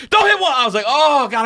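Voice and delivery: very high, loud mock panic; hammed-up urgent cry; retold frantic yell. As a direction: shout high to stop someone in time. Frantically stopping the emergency call.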